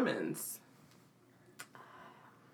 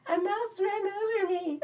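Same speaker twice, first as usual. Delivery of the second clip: put-on much higher, slow little-girl voice; imitating scared child; 14 semitones higher, 4 dB quieter, slower than usual. Woman imitating her childhood self telling her dad, high child voice. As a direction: say it small like a frightened kid. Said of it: "I'm like".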